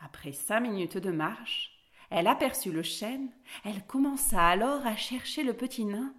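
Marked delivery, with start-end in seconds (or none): none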